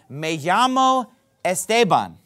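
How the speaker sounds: "Me llamo Esteban" said slowly in Spanish sounds; careful Spanish "Me llamo Esteban", trilled and slow; Spanish pronunciation. Shown as a demonstration. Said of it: "the most common way to say that would be".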